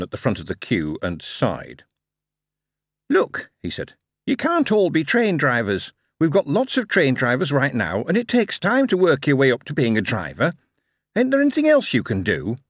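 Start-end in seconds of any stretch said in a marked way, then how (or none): 3.1-3.5 put-on high chirpy young clerk; bright, quick recruiting officer; high eager Billy voice. Billy Slick speaks. 4.3-12.6 put-on high chirpy young clerk; bright, quick recruiting officer; high eager Billy voice. Billy Slick speaks.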